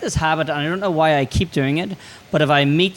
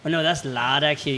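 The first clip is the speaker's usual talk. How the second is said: ordinary talk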